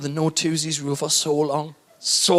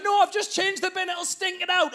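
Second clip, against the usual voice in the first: wife voice; 11 semitones higher, 4 dB quieter than usual. Retold or imitated. Wife's voice.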